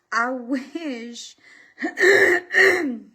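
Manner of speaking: congested, thick voice; stuffy voice. Real, not acted. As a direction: sound stuffed up. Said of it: "I didn't sound like snot".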